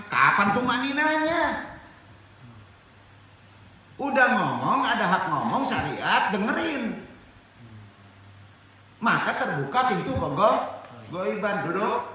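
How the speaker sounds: impassioned preacher scolding the congregation; forceful preaching. Real, not acted. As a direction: drive each phrase home loudly.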